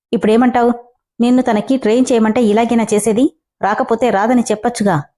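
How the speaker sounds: ordinary delivery, nothing marked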